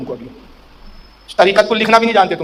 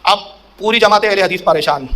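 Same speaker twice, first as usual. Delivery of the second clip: ordinary delivery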